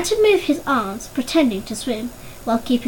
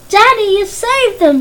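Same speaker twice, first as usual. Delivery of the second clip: loud, higher, slowed cry of joy; 8 semitones higher, 7 dB louder than usual. A narrator's reading. Boy cheers his father for saving them, loud glad cry. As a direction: cry it out with relief. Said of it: "he was crying".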